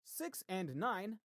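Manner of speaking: steady bingo caller call-out. Acted. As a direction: announce each number like a hall caller. Calls bingo numbers.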